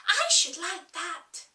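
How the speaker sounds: put-on high, delighted girl's voice; Alice, charmed. Alice thinking of the crab, high and charmed. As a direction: coo over the idea like a child.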